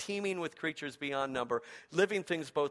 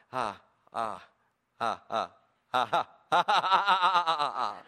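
hammed-up laugh; 4 semitones lower, 6 dB louder than usual. God's laugh naming Abraham.

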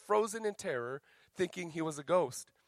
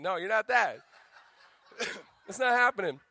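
laughing tease; 4 semitones higher, 6 dB louder than usual. Real, not acted. Teasing the men, audience laughs.

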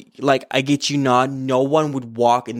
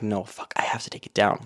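muttered to herself; 3 semitones lower, 7 dB quieter than usual. Retold or imitated. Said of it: "And I was like".

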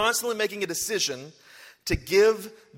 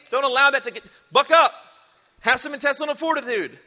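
higher, louder, pushing them to buck up; rousing shout; 6 semitones higher, 7 dB louder than usual. Acted. Preacher rousing the congregation with a loud push. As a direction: call them to toughen up, full voice.

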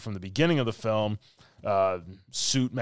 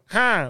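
loud, high nasal huff; comic snorted "huh"; snort out of the nose; 12 semitones higher, 7 dB louder than usual. Retold or imitated. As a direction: imitate a big nose noise. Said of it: "all, like, out of his nose".

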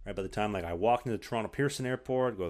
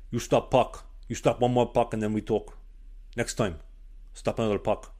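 louder, blunt Eastern European coach voice; 4 dB louder than usual. Retold or imitated. Coach refusing sticks to the goalie, Eastern European accent. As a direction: lay down the law like a gruff Slovakian coach. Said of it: "had a very thick Eastern European accent".

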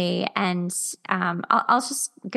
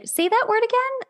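much higher-pitched mimicry of a voice teacher; imitated British teacher. Voice teacher querying a student's word, high-pitched mimicry. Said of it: "my voice teacher is British".

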